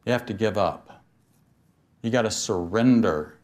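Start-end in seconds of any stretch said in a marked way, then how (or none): none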